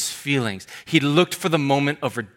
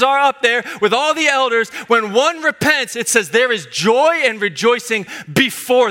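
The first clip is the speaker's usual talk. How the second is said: full-force preaching; 7 semitones higher than usual. Acted. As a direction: lift the room toward praise.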